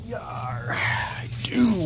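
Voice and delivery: gruff playful pirate growl. Pretend. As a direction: ham it up like a pirate. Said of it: "with the pirate voice".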